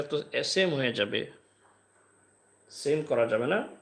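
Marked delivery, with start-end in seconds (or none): none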